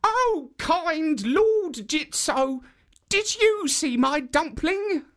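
put-on high, slow little old woman; high slow granny voice. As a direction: pipe it up like a sweet old granny. Old woman asks second statue.

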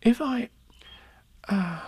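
put-on high child's voice, halting with long pauses; earnest, faltering boy. A narrator's reading. Christopher Robin tries to explain to Pooh, high faltering earnest voice. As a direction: struggle earnestly to get it out. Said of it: "said Christopher Robin earnestly".

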